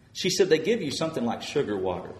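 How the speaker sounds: rising preaching fervour. Acted.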